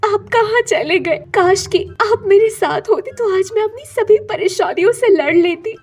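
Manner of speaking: acted crying, longing for papa; tearful pleading. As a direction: plead to an absent father, acting tearful. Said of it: "तस्वीर से रोते हुए बात कर रही".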